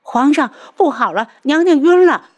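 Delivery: high, loud frightened outburst; panicked cry. A narrator's reading. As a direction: cry out in terror to the emperor. Midwife bursts out in panic.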